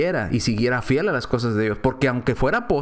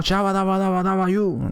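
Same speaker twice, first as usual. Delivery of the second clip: higher monotone babble mimicking tongues; imitated gibberish chant; mock speaking in tongues; 4 semitones higher than usual. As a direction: rattle off fake tongues on one raised note. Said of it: "lenguas así como".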